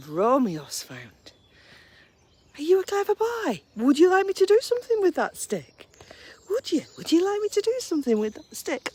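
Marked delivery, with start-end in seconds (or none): 2.6-8.9 doggy talk. Real, not acted. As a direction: tease the dog in a very high, loud sing-song. Baby talk to dog with stick.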